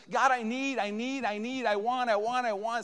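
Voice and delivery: mock demanding prayer; greedy begging prayer. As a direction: plead like a child who wants things. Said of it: "a time that says".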